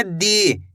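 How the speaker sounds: दी said with a long, held ई; drawn-out dee. Shown as a demonstration. Teacher demonstrating the long vowel, drawn out. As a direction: stretch दी slowly for the class. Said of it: "इसी तरीके से खींच के करना है".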